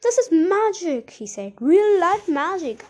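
high, loud exclamation of wonder; amazed delight. A narrator's reading. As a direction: marvel aloud at the discovery. Marvels at the transformed room.

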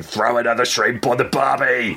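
brash Aussie barbie shout; laconic outback drawl. Pretend. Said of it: "abrasive Aussie guy in the background going".

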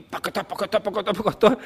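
rhythmic clip-clop syllables; galloping sound effect; imitated hoofbeats. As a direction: chant it like a trotting horse. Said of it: "em cima do cavalo".

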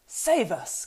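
loud, high exclamation of dread; alarmed cry. A narrator's reading. Butterbur hearing the name Mordor, alarmed cry. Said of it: "cried Mister Butterbur".

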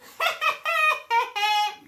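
loud, high mocking giant laugh; the giant scoffs at the knight; giant's laugh. A narrator's reading. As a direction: laugh the challenger to scorn. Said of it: "lachte die Riesin".

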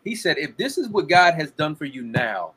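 usual speaking voice

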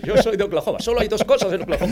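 mock movie recruit answer; Top Gun recruit. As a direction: play the cocky recruit, laughing through it.

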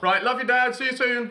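high, loud, quick farewell; high-pitched retold send-off; breezy acted goodbye. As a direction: imitate calling out a cheery goodbye. Acting out goodbye to dad.

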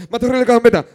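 loud, high, name said very clearly; over enunciating. Retold or imitated. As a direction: shout your full name for the recorder. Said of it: "Di tu nombre".

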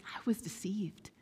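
hushed whispered confession. Retold or imitated. Preacher voicing James confessing he was deceived. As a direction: let it slip out as a whisper.

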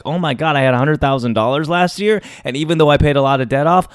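ordinary delivery

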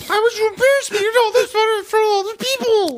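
high, wailing fake sob voice; hammed-up tearful plea, voice cracking high; mock crying. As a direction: whine it out like you're fighting back tears. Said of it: "You're trying to fight back tears".

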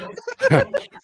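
very high burst of laughter. Real, not acted. Cracking up at the Vince joke, high laugh. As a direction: break into a high squeal of laughter.

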